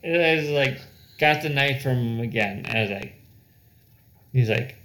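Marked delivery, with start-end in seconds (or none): none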